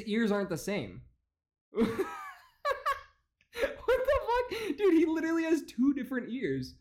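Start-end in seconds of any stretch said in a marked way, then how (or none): 1.7-2.3 real high-pitched cackle; burst of laughter. Real, not acted. Host cracking up at the politician's mismatched ears, high laughter. 3.8-6.8 very high, laughing incredulity; laughing disbelief. Real, not acted. Host reacting to two different ears, high laughing disbelief.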